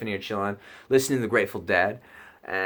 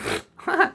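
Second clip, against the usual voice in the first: high giggle. Retold or imitated. Laughs at everything now.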